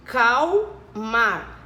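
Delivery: "Acalmar" in three slow, separate pieces; syllable by syllable. Shown as a demonstration. Teacher counting pieces with pupils, "Acalmar" syllable by syllable. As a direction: break "Acalmar" into a, cal, mar with pauses. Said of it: "Três pedacinhos".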